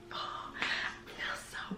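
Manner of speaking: slow hushed whisper, pretty drawn out. Real, not acted. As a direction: breathe it as a secret to the camera. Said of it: "Why am I whispering?".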